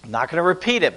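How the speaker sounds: usual speaking voice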